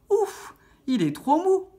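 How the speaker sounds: high, slow, loud complaint; dismayed huff. A narrator's reading. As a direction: huff it out like a put-out little girl. Goldilocks sinks into soft chair. (soupir contrarié, aigu et traînant)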